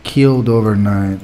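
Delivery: put-on heavily French-accented game character. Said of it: "These people are super French".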